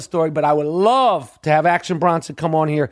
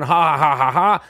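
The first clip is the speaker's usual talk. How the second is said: mock laugh; 2 semitones lower than usual. Imitating Jordan and Moses Malone laughing together, mock laugh. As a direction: ham up the players' laughter.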